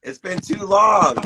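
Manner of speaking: high, loud and drawn out; delighted reunion greeting. Real, not acted. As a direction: stretch it out, thrilled to reconnect. Happy reunion with a friend.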